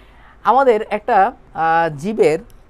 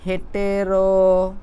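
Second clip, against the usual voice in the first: syllable by syllable; 3 dB louder, much slower than usual. Shown as a demonstration. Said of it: "যখন তোমাদের মতো ছিলাম তখন আমি এভাবে লিখতাম".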